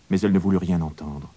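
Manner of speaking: plain reading voice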